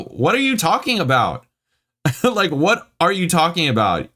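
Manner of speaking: high-pitched, incredulous outburst; real exasperation. Real, not acted. Reader challenges the review's writer, exasperated and incredulous. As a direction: demand an answer, voice jumping way up. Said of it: "this makes me mad".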